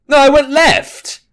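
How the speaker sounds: high, slow exasperated cry; frustrated outburst. Real, not acted. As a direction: protest it like you've been cheated. Frustrated at game turning.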